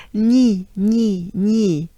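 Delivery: "Ni" loud, as "nyee" with French gn; banana-smile "ni". Shown as a demonstration. Teacher drills funny sounds, banana-smile "ni". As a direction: punch out "ni" with lips spread. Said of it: "goes into the banana smile".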